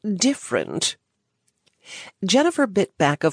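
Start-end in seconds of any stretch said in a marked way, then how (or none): 0.0-1.0 dismissive sniff. A narrator's reading. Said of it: "he sniffed dismissively".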